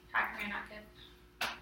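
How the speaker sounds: almost hushed, drawn out; tender hushed repeat; very soft, slow concern. Real, not acted. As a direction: coax it out gently. Teacher repeats question very softly.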